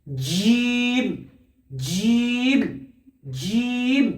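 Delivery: জিম with mid tongue on the palate; careful letter drill; Arabic letter demo. Shown as a demonstration. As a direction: repeat জিম slowly, stretched out. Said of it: "জিম উচ্চারণ হয়".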